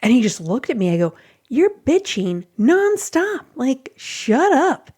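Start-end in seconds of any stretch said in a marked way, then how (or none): 1.5-4.9 higher, drawn-out exasperation; retold pissed-off outburst. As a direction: re-enact telling off a complainer, stretching the words. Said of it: "I was, like, so pissed".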